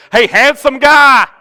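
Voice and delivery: high, very loud, drawn-out holler; yelling. Acted. Joking call to Curtis instead of his name, yelled. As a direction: yell it teasingly across a crowd. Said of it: "yell for Curtis".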